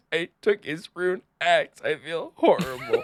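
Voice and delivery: high, hammed-up weepy confession; mock crying. Imagined child confessing a scam to parents, mock crying. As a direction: fake a tearful wail, half laughing. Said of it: "go running crying to my parents".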